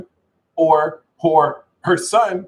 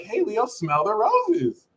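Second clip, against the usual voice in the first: mellow Taurus; 7 semitones higher, 6 dB quieter than usual. Retold or imitated. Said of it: "Taurus can slow Leo down and be like".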